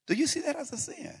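high, judging, accusing question; condescending. Shown as a demonstration. Pastor showing how he could have scolded the waiter, condescending. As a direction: rise up high and look down on him. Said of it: "I could have said".

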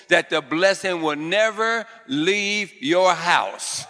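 slow, loud preaching proclamation; drawn-out preacher's decree; fervent declaration. Real, not acted. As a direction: declare it over the whole house. Preacher declaring blessing over congregation.

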